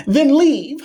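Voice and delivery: a cartoon-high brush-off; tossed-off falsetto dismissal; squeaky mock voice. Mocking repeat of dismissal.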